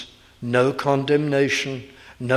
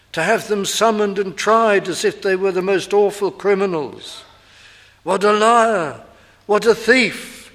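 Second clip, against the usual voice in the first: loud, higher pulpit outcry; impassioned preaching; 8 semitones higher, 7 dB louder than usual. Acted. Preacher to the congregation, crying out in self-accusation. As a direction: hammer out each charge.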